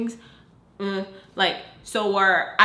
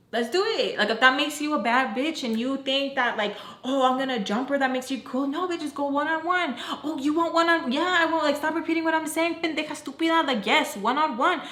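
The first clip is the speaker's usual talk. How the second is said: high-pitched, heated argument; confrontational; 6 semitones higher than usual. Retold or imitated. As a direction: retort in a raised, combative pitch. Acted quarrel, daring her to fight.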